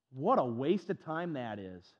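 ordinary talk

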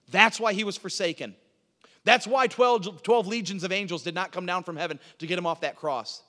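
loud, emphatic pulpit delivery; full-force preaching. Acted. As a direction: hammer each repeated reason home.

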